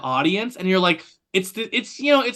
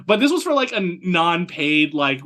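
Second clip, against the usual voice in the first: ordinary talk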